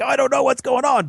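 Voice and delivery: mock-baffled viewer; confused viewer. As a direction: whine it up high. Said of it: "You're supposed to be going like".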